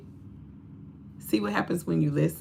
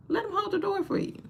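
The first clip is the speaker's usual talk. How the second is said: much higher, softer, pleading; high squeaky plea; 6 dB quieter than usual. Acted. Urging women to let men hold the door, high and soft.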